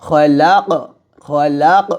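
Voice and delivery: খলাক ended with an echoing bounced ক; qalqala on qaf. Shown as a demonstration. Teacher demonstrating qalqala on the stop, echoing final ক. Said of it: "কলকলাটা এরকম হবে".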